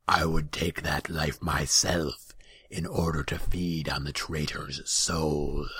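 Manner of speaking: rough demon bargain, slowing toward the end; rumbling growl. A narrator's reading. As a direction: growl the terms deliberately. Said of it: "His voice was a rumbling growl".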